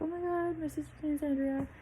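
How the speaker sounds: bright excited quote of the doctor; mock excited doctor. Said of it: "He's like".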